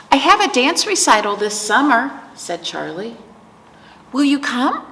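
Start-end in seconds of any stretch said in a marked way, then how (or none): 0.1-2.2 a higher, louder hint of an eager little girl; lightly voiced child. Voices child Charlie asking grandma. 4.1-4.9 lightly voiced child, pitched up; a hint of a hopeful little girl asking; Charlie. Voices child Charlie inviting grandma.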